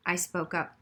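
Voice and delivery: plain reading voice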